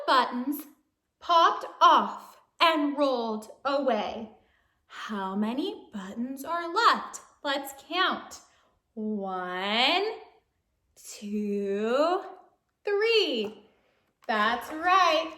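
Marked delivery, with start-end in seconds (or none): none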